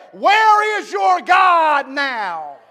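loud, slow, high jeering shout; the world taunting a believer, loud and slow. Acted. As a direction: sneer it out, dragging every word.

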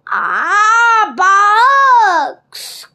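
loud, drawn-out growl of disgust. A narrator's reading. Captain hearing bad news from his crewman, growling. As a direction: growl out the disappointment.

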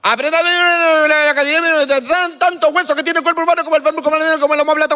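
high, rapid mock recital; mocking classmates. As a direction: parrot the nerdy classmates. Classmates reciting anatomy all day.

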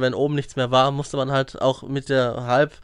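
usual speaking voice

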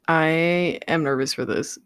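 ordinary talk

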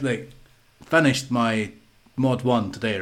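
normal talking voice

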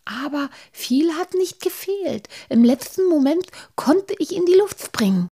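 high, squeaky stage whisper; excited, high-pitched little character; whispered back. A narrator's reading. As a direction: tell the near miss in a high voice. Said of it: "flüsterte Halbschuh zurück".

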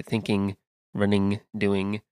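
thinking, running, doing with the final g fully pronounced; full final g. Shown as a demonstration. Host contrasting his own speech, careful full g. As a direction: land the ng ending on each word. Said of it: "whereas obviously I would say".

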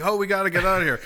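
high-pitched hurried fake alarm; lightly hammed-up urgency; mock panic. Acted children fleeing the housekeeper.

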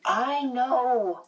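put-on high-pitched, insistent child; little brother shutting down doubt; mildly impatient boy's assertion. A narrator's reading. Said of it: "he said impatiently".

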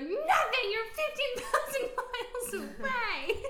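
high, loud despairing cry to dad; mock tearful wail. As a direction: wail it hysterically, stretching the end. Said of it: "I was just so sad".

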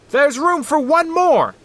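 shouting over machinery. A narrator's reading. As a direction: bellow over the rotor noise. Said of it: "the little co-pilot yelled".

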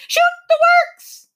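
very high, loud bird screech; ferocious shriek. A narrator's reading. Excited Wiffle Bird flying around, shrieking ferociously. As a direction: shriek it, stretching the words. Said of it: "shrieking ferociously".

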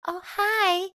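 high flirty greeting; coy, high-pitched mock flirting. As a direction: say it like a playful flirt. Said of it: "She's being a little flirty".

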